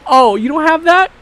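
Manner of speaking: loud hammed-up eviction; mock shout. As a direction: yell it as a joke. Mock kicking her out.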